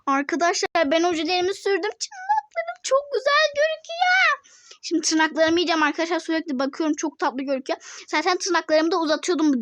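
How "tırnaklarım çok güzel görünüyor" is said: high, slow and squeaky, showing off the nails; a cutesy high-pitched brag; slow, high, playful voice. Pretend. Showing off new nails to viewers.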